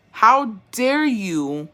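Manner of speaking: very slow, drawn-out offence; scandalised, dragging every word; indignant outrage. Pretend. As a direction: stretch it out in mock outrage. Telling off the movie's bully.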